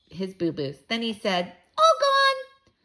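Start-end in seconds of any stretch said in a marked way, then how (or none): 1.8-2.7 put-on high, loud toddler voice; Fudge announcing. A narrator's reading. Little brother telling mother about his teeth, high and babyish. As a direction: babyish small-child squeal.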